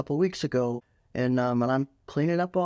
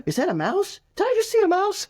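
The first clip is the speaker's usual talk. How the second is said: much higher and louder, staged alarm over a mouse; mock surprise; 4 dB louder than usual. Shown as a demonstration. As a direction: startle the screaming child into looking. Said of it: "Or distract 'em".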